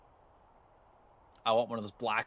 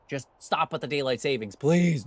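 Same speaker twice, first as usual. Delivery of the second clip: loud plea; 2 semitones higher, 7 dB louder than usual. Real, not acted. Begging daylight savings to end.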